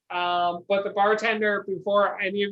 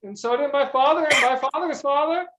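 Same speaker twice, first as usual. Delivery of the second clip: peasant voice; 5 semitones higher than usual. Acted. DM answers as peasant.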